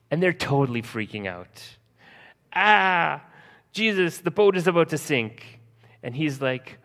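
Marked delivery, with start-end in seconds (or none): 2.5-5.6 high, loud frantic cry; panicked disciples. Retold or imitated. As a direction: yelp it out in mock panic as the boat sinks. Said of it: "They're going crazy".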